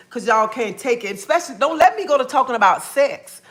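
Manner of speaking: usual speaking voice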